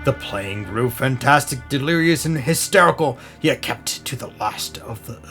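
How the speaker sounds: plain reading voice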